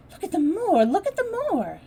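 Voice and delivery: high, loud call across the room; a girl calling out in delight; excited calling out. Acted. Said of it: "called to Martha".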